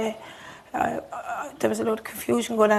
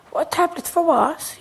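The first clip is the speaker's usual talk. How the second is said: higher, louder and slow; stunned disbelief; 7 semitones higher, 6 dB louder than usual. Retold or imitated. Grieving mother recalling her disbelief, high and slow. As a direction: drag out the question, not believing it.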